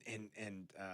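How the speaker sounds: ordinary talk, nothing marked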